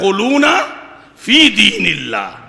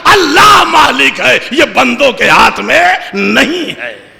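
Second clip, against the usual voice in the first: higher, loud pulpit delivery; full-force preaching; 11 semitones higher, 12 dB louder than usual. Acted. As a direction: preach it out to the whole gathering.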